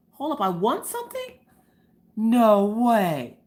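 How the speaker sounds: hammed-up disbelief; retold astonishment. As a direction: build from puzzled to amazed.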